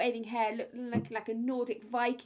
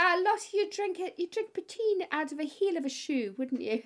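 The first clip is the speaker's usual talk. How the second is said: mock Irish accent, pitched up at the start; terrible Irish accent; 6 semitones higher than usual. Irish friend teasing Lottie over her drinking, mock Irish accent. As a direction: tease in a bad Irish lilt. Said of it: "my terrible Irish accent".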